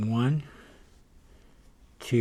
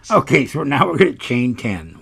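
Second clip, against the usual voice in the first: raised voice over noise; yelling over leaf blower; 5 semitones higher, 9 dB louder than usual. Real, not acted. Talking over neighbor's leaf blower.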